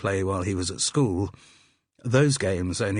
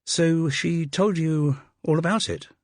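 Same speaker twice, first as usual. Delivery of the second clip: slow, higher puzzled question; a little puzzled; 9 semitones higher, 3 dB louder than usual. A narrator's reading. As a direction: ask slowly, a touch puzzled, pitch lifted. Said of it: "a little puzzled".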